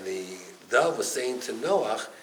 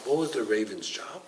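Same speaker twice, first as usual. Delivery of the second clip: ordinary talk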